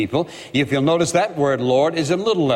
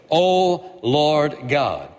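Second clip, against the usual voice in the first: slow, solemn O Lord God; each word of "O Lord God" drawn out slowly; drawn-out invocation; much slower than usual. Acted. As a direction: stretch out "O Lord God" reverently. Preacher repeats prayer opening slowly.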